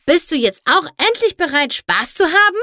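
high, loud bellowed question; Applejack yelling. A narrator's reading. Applejack bellows at Fluttershy whether she is finally ready, yelling. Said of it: "brüllte Applejack".

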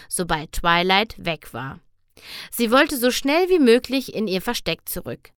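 ordinary delivery

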